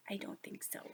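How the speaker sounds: soft breathy whisper. Real, not acted. Child whispering an answer to the storyteller.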